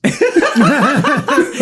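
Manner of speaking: a bright burst of laughter; high laugh. Real, not acted. Telling friends about the drive-thru standoff, laughing. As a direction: crack up at the memory.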